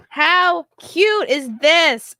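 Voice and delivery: high, loud and slow, cooing over it; high slow gushing coo. Real, not acted. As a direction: stretch out cute in delight. Gushing over baby clothes.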